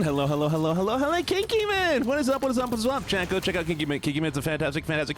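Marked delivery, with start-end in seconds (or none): 0.0-2.9 high sing-song greeting. Real, not acted.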